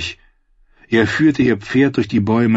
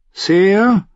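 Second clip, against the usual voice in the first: put-on high, louder, hesitant young squire; shy boy's voice calling a dazed knight; Podrick calling; 12 semitones higher, 4 dB louder than usual. A narrator's reading. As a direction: call out timidly from afar. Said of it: "sagte er ständig".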